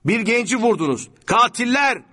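high, loud accusation; outraged scream. A narrator's reading. Sami Bey screams at departing gunmen, outraged. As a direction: yell it after a fleeing car. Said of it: "diye haykırdı".

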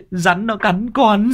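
high, slow drawn-out wail; wailing lad. Acted. Tâm wails to uncle about the bite, slow high wail. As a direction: stretch it out in pain.

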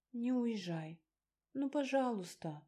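high, wheedling request; acted pleading; coaxing plea. A narrator's reading. Coaxing him not to leave.

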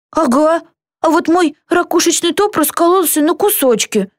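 high-pitched cross complaint; angry grumble. A narrator's reading. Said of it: "сердито сказал он". (сердито, высоким голосом)

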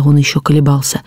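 ordinary delivery

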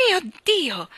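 high, loud gasped exclamation; open-mouthed astonishment. A narrator's reading. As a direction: exclaim it in shock. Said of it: "esclamò a bocca aperta". (esclamazione acuta di stupore)

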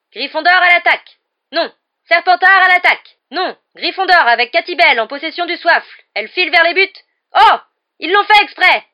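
high, shouted play-by-play; frantic sports commentator. A narrator's reading. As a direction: follow the attack, then cry foul indignantly. Commentates a frantic exchange as Lee. (commentateur affolé et indigné)

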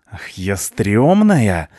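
slow, drawn-out menacing outrage; furious hissing. A narrator's reading. Cleaner insulted by the punk, hissing with fury. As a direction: stretch the word out in loud indignation. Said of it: "зашипел на него Швабра".

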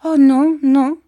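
put-on high, loud childlike cry; loud, high-pitched protest; exclaiming. A narrator's reading. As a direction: cry out in a girlish voice. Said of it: "s'écria Dora".

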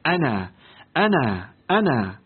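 low, distant echo; a remembered voice quivering in memory; the one man's voice echoing in his ears, low and far; voice trembling in his ears. A narrator's reading. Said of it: "يضطرب صوته في أذنيه".

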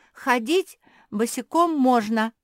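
high, loud protest; shouting. A narrator's reading. Kuki calls out from inside the bush, shouting. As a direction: yell it out indignantly. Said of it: "закричала из куста Куки".

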